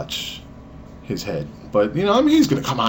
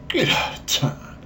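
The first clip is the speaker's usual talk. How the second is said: breathy stunned whisper; a hushed gasp of amazement; awed whisper; 7 semitones higher than usual. Retold or imitated. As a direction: whisper it in disbelief. Comic whisper of disbelief.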